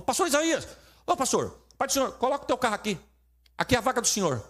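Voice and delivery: high, eager usher voice calling out; lightly voiced eager usher; eager church worker. Church worker calling pastor over.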